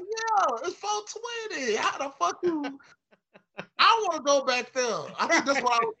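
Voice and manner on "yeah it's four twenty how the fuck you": high, laughing disbelief; mock incredulous self. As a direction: squeal it out in high mock outrage. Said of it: "I'm like".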